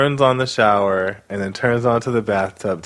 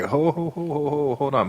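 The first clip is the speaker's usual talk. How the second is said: higher, a stammering hurried ho ho ho; alarmed hold on; 5 semitones higher, 4 dB quieter than usual. Retold or imitated. Producers stopping the wife walking out, alarmed and higher. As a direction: halt her before she walks out.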